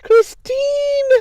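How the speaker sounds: very high, loud shout then laughter; delighted scream of a name; excited shout. Real, not acted. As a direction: shriek the name with joy. Greeting a viewer, shrieking.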